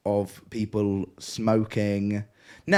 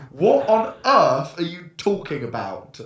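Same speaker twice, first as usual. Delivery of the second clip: mock disbelief; 7 semitones higher, 5 dB louder, slower than usual. Disbelief at drug trafficking accusation.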